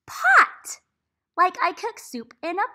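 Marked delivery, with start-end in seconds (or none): 0.1-0.8 excited reveal. Shown as a demonstration. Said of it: "blending the word".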